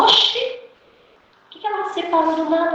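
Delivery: high, startled "Oxi, why"; a jolt of pretend disbelief; mock surprise. Reacting to sudden separation.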